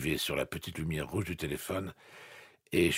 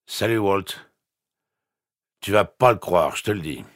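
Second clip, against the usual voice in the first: put-on drunk voice; 4 semitones higher, 9 dB louder than usual. A narrator's reading. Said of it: "Il avait l'air bourré".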